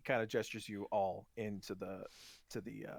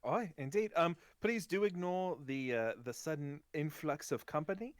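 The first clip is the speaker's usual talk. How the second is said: put-on higher, courteous accented gnome; gnome merchant; 5 semitones higher than usual. Gnome shopkeeper apologising for sudden company, high and courteous. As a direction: excuse the crowd in a high put-on voice. Game master voices the gnome.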